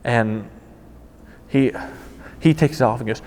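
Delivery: ordinary talk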